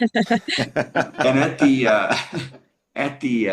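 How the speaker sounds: breaking into laughter mid-sentence; slow, laughing through the words; can't get it out for laughing. Real, not acted. Laughs through his own story.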